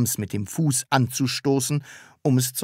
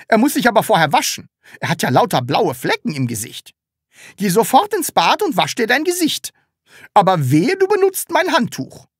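high, loud scolding lecture; shrill scolding landlady; 9 semitones higher, 5 dB louder than usual. A narrator's reading. Landlady orders the child to wash, loud and high. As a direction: nag the child sharply.

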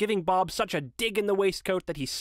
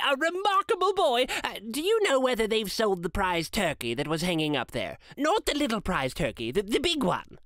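lightly voiced Scrooge, pitched up in delight; eager Scrooge; 7 semitones higher than usual. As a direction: quiz the boy eagerly, lifting the key words high.

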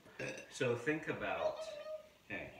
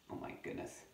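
plain reading voice

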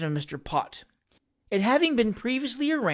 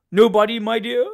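higher, louder teasing question; arch exclamation; 4 semitones higher, 7 dB louder than usual. A narrator's reading. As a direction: exclaim it archly, pitched up. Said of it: "exclaimed Mister Pott archly".

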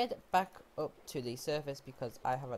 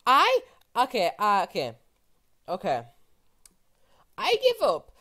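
loud and high, can't believe the luck; throwing up hands in loud exasperation; real overwhelmed outburst; disbelieving outburst; 6 semitones higher, 14 dB louder than usual. Real, not acted. Yet another diamond vein found.

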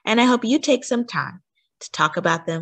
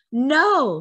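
shouted; 7 semitones higher than usual. A narrator's reading. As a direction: cut in sharply with a raised voice. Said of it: "shouted a voice".

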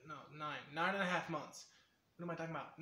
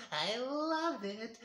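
high, slow, gleeful mad scientist; high gleeful mad scientist with a cackle; 10 semitones higher, 4 dB louder, much slower than usual. Shown as a demonstration. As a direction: savor every word like a cackling mad genius.

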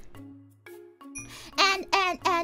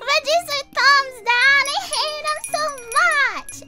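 playful pouty outburst, louder and higher; mock tantrum; 7 semitones higher, 6 dB louder than usual. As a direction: throw a cute fake tantrum about veggies. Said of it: "I'm having a mini tantrum".

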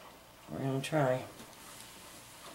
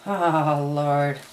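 exasperated; 8 semitones higher, 10 dB louder than usual. Real, not acted. Piping goes wrong, exasperated.

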